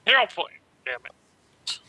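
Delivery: high, loud annoyed cry; frustrated squeal. Real, not acted. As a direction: whine it out loud. Player frustrated.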